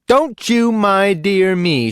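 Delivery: loud, high, slow snapping back; furious wife, stretched-out loud rebuke; angry retort. A narrator's reading. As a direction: throw his words back at him. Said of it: "she answered".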